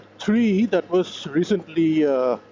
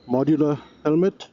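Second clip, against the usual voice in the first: ordinary talk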